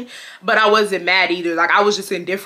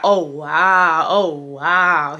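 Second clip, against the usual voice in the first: imitated shock; 3 semitones lower, much slower than usual. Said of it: "He just kept saying".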